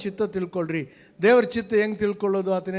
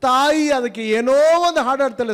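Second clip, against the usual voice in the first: higher, louder impassioned preaching; fervent preaching; 5 semitones higher, 6 dB louder than usual. Acted. Preacher telling the flock of a mother's song, impassioned. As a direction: lift the congregation with the image.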